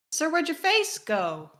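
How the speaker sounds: high, loud, hammed-up question; mock incredulity. Host addressing a faceless character, mock incredulous. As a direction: call him out, playfully baffled.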